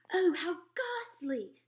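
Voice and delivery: queen horrified by the newspaper; cried out. Acted. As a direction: recoil in horror at the news. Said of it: "the famous voice cried out".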